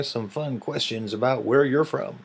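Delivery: ordinary talk, nothing marked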